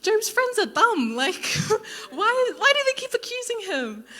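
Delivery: high, loud, laughing rant; exasperated outburst. Retold or imitated. Bored reader rants that Job's friends are dumb, squealing. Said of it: "I was getting bored 'cause I was like".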